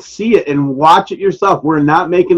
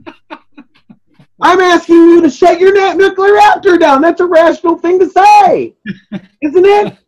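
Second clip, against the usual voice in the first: high, loud, near tears, pleading to be seen as rational; emotional outburst; 12 semitones higher, 3 dB louder than usual. Real, not acted. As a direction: voice cracking up with emotion, half laughing. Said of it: "I'm very emotional".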